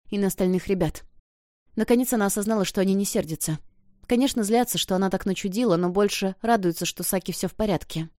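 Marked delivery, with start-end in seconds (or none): none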